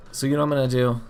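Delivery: ordinary talk, nothing marked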